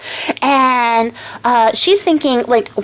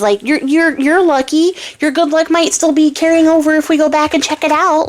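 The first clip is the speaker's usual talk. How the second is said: higher, brighter-pitched eager dog, pushing his master on; 6 semitones higher than usual. Acted. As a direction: cheer him up like a loyal dog. Dog Frisk encourages his master.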